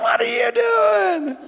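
high-pitched imitated holler; hollered greeting. Cousins calling to Ryan as he pulls up, hollering. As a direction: mimic the relatives calling out high.

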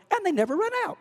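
sudden high-pitched clown voice. Retold or imitated. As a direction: leap way up high on the last words. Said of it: "in that high-pitched voice at the end".

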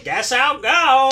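high, slow, very loud call; loud mock announcement. Driver giving up on kids, imitated loud announcement. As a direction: announce a departure for hidden ears.

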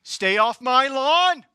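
high, loud, drawn-out mock yell; that old guy. Old guy warning people off his lawn, hammed-up slow shout. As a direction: holler it like a hammed-up old homeowner. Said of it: "I'm that old guy".

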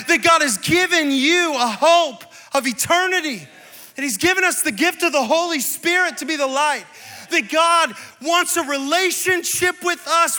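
high, loud, impassioned sermon build; full-throated preacher's crescendo; preaching at full force. Acted. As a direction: lift the crowd with every "what if". Preaching climax, repeated "what if.

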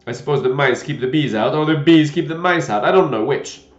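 put-on loud, discontented old knight; grumbling old knight complaint; disgruntled muttering. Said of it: "in a discontented tone".

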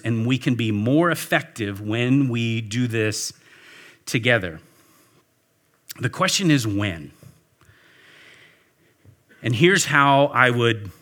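usual speaking voice

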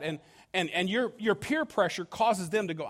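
ordinary talk, nothing marked

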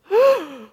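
loud sudden intake of breath, high and startled; sharp gasp. Retold or imitated. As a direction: gasp in shock like a psychic seeing something. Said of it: "and she goes ... like this".

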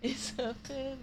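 high, soft put-on singer impression. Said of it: "[name] said in my [name] voice".

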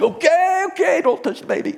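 high, loud panicky pleading; nervous backing off. Retold or imitated. Surfer backing off from mama dolphin, high panicky plea. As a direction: back away fast from an angry mother dolphin. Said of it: "I'm like".

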